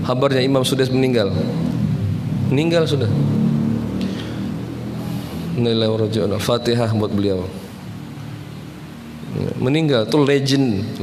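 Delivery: ordinary talk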